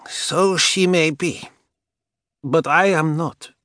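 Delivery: put-on high, slow old woman voice. A narrator's reading. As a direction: voice the old empress slowly. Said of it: "said the old woman beside her".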